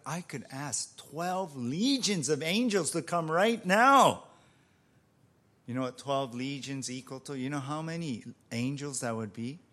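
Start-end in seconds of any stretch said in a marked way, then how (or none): 0.1-4.2 retold rebuke, rising in force; forceful rebuke. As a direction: assert overwhelming power, ending in a shout. Jesus rebukes Peter, building.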